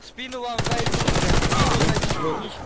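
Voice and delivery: urgent shout. Real, not acted. Urgent callout during fight.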